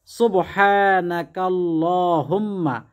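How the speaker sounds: each Arabic syllable drawn out very slowly, a measured chant; slow careful recitation. Shown as a demonstration. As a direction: recite the prayer slowly, syllable by syllable, as a model to copy. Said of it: "উত্তম হলো সুবহানাকাল্লাহুম্মা মিলিয়ে পড়া".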